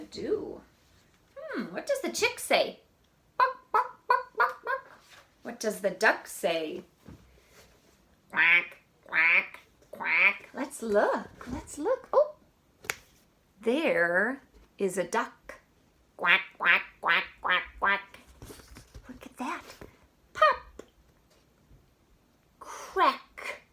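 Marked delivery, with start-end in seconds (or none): 3.4-5.0 high squeaky chick clucks; peeping baby chick, high up. Acted. Chick sound in picture book. 8.3-10.5 lower, louder quacking; a lightly voiced duck quack, louder. Duck sound in picture book. 16.2-18.2 lower, louder quacking; a lightly voiced duck quack, louder. Duck sound in picture book. 20.3-20.8 high loud pop; a squeaky popping noise; popping sound. Acted.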